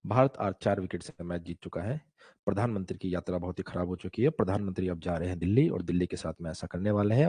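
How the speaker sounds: deep, monotone Doordarshan news read, no expression at all; flat newsreader. Retold or imitated. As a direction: drone the headlines like a bored old news anchor. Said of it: "वो ऐसे news पढ़ते थे".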